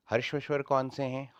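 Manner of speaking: usual speaking voice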